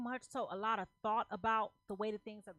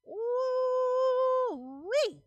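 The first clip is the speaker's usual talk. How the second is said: high, loud delighted ooh-wee; excited whoop; 12 semitones higher, 11 dB louder than usual. Real, not acted. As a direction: let out a thrilled whoop at the card. Tarot card reveal, reader exclaims.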